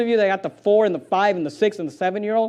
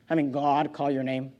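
normal talking voice

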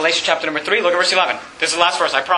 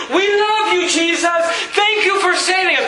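mock gushing praise; 10 semitones higher, slower than usual. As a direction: cry out like an overjoyed crowd greeting Jesus. Congregation gushing at Jesus in heaven.

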